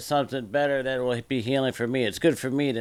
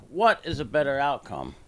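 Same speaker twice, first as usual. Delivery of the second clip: usual speaking voice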